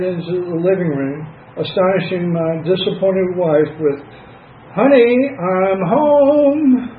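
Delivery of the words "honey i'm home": high, drawn-out mock drunk call; drunken greeting. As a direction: call it out high like a tipsy husband. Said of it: "I was stewed to the max".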